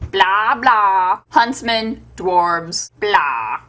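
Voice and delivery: ordinary talk, nothing marked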